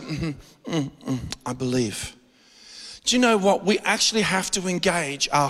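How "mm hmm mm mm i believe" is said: mock lazy believer. Mocking passive believer.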